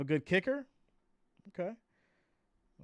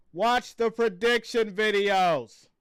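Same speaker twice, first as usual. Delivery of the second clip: exasperated; 7 semitones higher, 9 dB louder, much slower than usual. Real, not acted. Exasperated reply to viewers.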